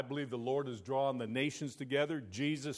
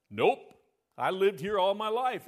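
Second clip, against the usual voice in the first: loud farmer; 5 semitones higher, 6 dB louder than usual. Acted. Farmer answers in a joke.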